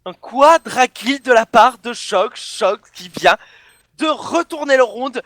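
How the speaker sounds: hyped commentary. Real, not acted. Caster hyped after clutch.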